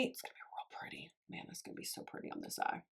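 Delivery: hushed whisper to herself, barely voiced. Real, not acted. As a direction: breathe it out as a private aside. Admiring the shade under her breath.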